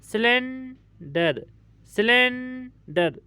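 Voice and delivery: Cylinder drawn out, broken before डर; syllable break. Shown as a demonstration. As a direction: hold silane, pause, then say डर in cylinder. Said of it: "हमारी आवाज़ टूट गई". (Cylinder को धीरे, डर से पहले रुककर)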